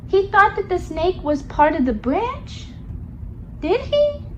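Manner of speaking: ordinary delivery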